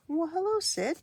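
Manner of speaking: high, loud delighted hello to a cat; pet talk. Real, not acted. Greeting the cat.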